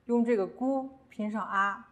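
usual speaking voice

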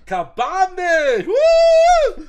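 hyped up. Real, not acted. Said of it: "Ich bin aufgedreht".

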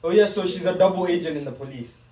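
ordinary delivery

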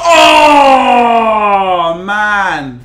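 loud raw shout of frustration; frustrated yell. Real, not acted. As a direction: let out an angry groaning yell. Match goes wrong, real outburst.

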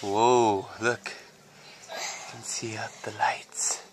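hushed and slow, breath only; whisper. Real, not acted. Mother whispers into the children's play tent. Peeking into kids' tent at night.

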